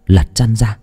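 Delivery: ordinary delivery, nothing marked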